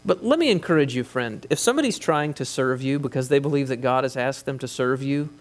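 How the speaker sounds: ordinary talk, nothing marked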